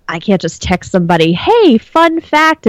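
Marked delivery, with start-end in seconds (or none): 1.3-2.7 high, drawn-out singling out of news; mock excited announcement. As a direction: announce it slowly and brightly, pitched up. Imagined excited text to somebody.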